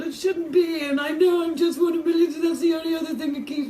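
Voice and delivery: high, loud cry of despair; anguished shout. Real, not acted. Sufferer crying out that she is one of millions, anguished. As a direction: let it burst out of you.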